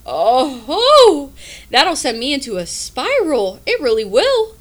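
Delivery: a hammed-up freak-out at the thought; loud dramatic dread; mock panic. Real, not acted. Panicking about life spiral.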